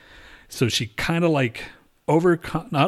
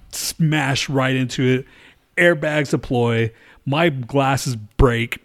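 ordinary talk, nothing marked

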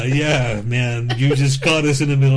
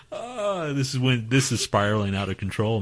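normal talking voice

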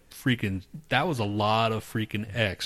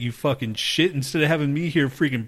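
imitating a furious rant, pitched up; retold angry rant; 6 semitones higher than usual. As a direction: yell it like you've been betrayed.

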